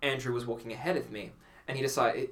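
usual speaking voice

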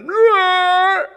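loud mock roar, high and shouted; dog roar. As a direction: roar like a huge dog yawning. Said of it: "and he's like".

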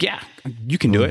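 mock-supportive cheer, pitch swinging up; sarcastic support. Doubting trainer humouring his injured friend, sarcastically supportive. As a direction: play up the encouragement without believing it. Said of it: "trying to be supportive, and I'm being sarcastic".

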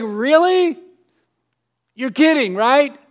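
mock their surprise with sarcasm; incredulous.

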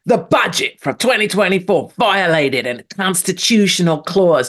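put-on light Arnold-style accent, dramatic read; mock Arnie movie voice. Host reading news, lightly mimicking an Arnie movie. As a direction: read the budget news like an action trailer. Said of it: "Trying to make it sound like an Arnie movie".

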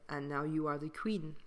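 ordinary talk, nothing marked

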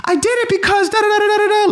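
high squeaky mock girlfriend voice; mocking her voice. Recording the drunk girlfriend, high mocking whine. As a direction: parrot her excuses in a silly high voice. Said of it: "interviewing her".

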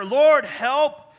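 very loud, high, drawn-out plea; preacher's dramatized shout for rescue; terrified cry. Retold or imitated. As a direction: cry out to God from a storm. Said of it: "they cried in their trouble".

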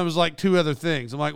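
ordinary delivery

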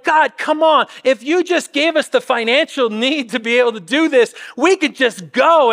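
loud, high pleading cry to God; pleading prayer. Retold or imitated.

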